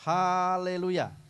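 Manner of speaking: Haleluya stretched out slowly; slow, drawn-out model word. Shown as a demonstration. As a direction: say Haleluya slowly for them to copy. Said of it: "Ikutin saya bicara".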